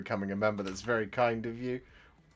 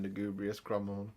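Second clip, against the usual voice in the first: ordinary talk, nothing marked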